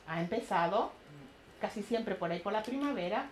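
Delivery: usual speaking voice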